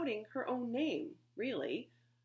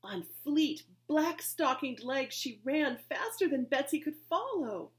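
plain reading voice

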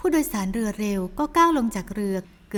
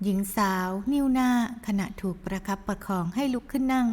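plain reading voice

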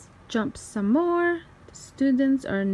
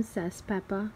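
ordinary delivery, nothing marked

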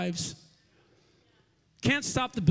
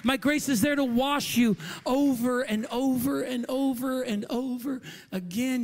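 high, raised preacher's voice; impassioned preaching; 6 semitones higher, 4 dB louder than usual. Acted. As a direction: preach it with rolling repetition, easing at the end. Preaching at full force.

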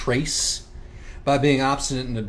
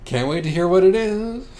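sarcastic; 9 semitones higher, 7 dB louder than usual. Acted. Sarcastic reply in dialogue.

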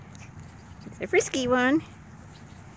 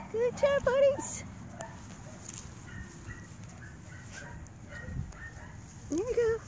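puppy talk; 12 semitones higher, 4 dB quieter than usual. Real, not acted. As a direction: coo at the puppies up high. Praising puppies, very high.

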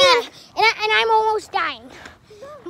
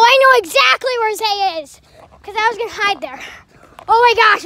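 normal talking voice

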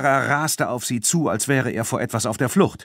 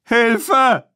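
even higher, louder panicked scream; desperate shriek; 14 semitones higher, 7 dB louder than usual. A narrator's reading. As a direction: shriek it as the bull closes in. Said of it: "schrie Lupin".

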